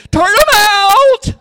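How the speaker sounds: loud, high, drawn-out holler; full-throated imitation of his own yell; retold yell.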